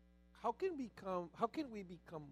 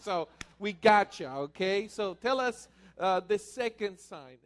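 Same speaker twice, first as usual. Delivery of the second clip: much higher and louder, teasing the crowd; excited calling out; 7 semitones higher, 11 dB louder than usual. Real, not acted. Host teasing audience who didn't raise hands, loud and high. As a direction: call out to the audience with a grin. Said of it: "host calling out to audience".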